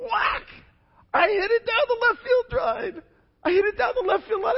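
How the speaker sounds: soaring high, fast excited retelling. Retold or imitated. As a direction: burst out like a thrilled kid. Excited retelling of hitting ball.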